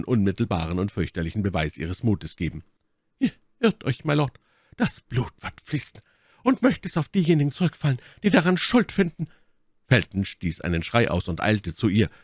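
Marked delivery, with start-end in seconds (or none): 3.2-9.3 high, defiant lady's voice; Milady, trotzig. Acted. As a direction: curse those to blame, high and urgent. Milady defies the Baron. (Mylady, hohe trotzige Stimme)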